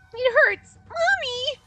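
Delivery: put-on very high pained child wail; sobbing little girl. A narrator's reading. Little girl hurt in a fire, sobbing. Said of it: "the crying girl".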